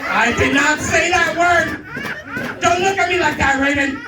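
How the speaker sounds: high, loud and slow protest; mock indignant shout. Real, not acted. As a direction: yell at Raven in fake outrage. Mock defending himself to Raven.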